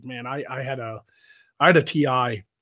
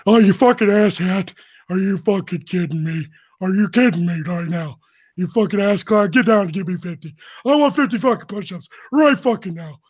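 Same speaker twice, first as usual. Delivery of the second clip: loud, raised drill-instructor voice with a weird edge; Technical Sergeant Ramscock; 6 semitones higher, 6 dB louder than usual. Retold or imitated. Impression of his T I demanding fifty push-ups, barking sergeant. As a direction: chew out a recruit in a weird sergeant voice. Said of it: "he talked like this".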